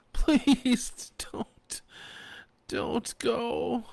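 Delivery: high, breathy whispered begging; desperate plea. A narrator's reading. Fanny begging the men not to go. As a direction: beg them in a broken whisper. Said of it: "Fanny cried".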